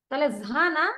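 ordinary talk

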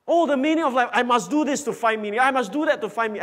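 high-pitched, hurried mimicry; mock striving. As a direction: gabble it like someone desperate to earn it. Preacher mimics people striving for self-salvation.

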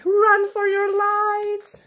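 high, loud, drawn-out alarm; panicked storybook shout; cried out. Acted. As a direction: yell for everyone to flee. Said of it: "cried Alby".

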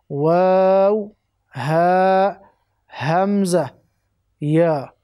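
long-held letters, each slightly rising, firmly projected; letter recitation. Shown as a demonstration. Teacher modelling Arabic letters for students, slow drawn-out recitation.

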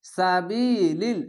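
সাবিলিল drawn very slowly, long ি; slow recitation. Shown as a demonstration. Teacher demonstrating tajweed: slow drawn reading. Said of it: "এক আলিফ টেনে পড়বেন".

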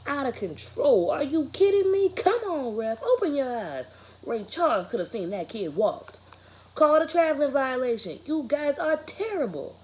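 loud, high, outraged fan yelling; yelling at refs. Acted. Dad in the stands yelling at the officials, loud and outraged. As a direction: bellow at the referee from the stands.

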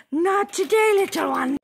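high playful voice to a pet. Real, not acted. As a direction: coo at the little animal. Talking to a sneaking animal.

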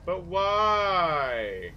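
drawn-out, playful lament; mock guilt. Gamer regretting the assassination of Corporal Kelly, hammed-up guilt. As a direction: stretch the last words into a guilty whine.

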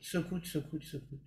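سکوت repeated, fading to near silence; fading demo. Shown as a demonstration. Teacher showing the silent start to students, fading out. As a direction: let سکوت fade away with each repeat. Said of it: "سکوت کنید".

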